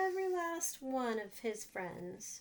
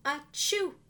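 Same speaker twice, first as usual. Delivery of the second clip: a loud, higher mock sneeze; acted sneeze; 5 semitones higher, 7 dB louder than usual. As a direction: fake a big sneeze for the kids.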